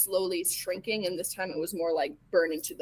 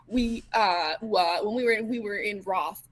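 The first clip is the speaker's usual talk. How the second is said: nervous stammer, words tripping over each other; stuttering; slower than usual. Acted. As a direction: stumble over each start of the sentence. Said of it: "the stuttering is so good".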